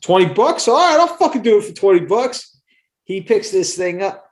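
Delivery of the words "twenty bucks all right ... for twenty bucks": high, loud, excited yelp; eager accepting the bet. Retold or imitated. Buddy Rob jumping at the ketchup bet, high and loud. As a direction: jump at the twenty bucks like a dumb kid.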